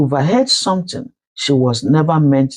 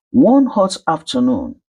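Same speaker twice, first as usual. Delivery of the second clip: usual speaking voice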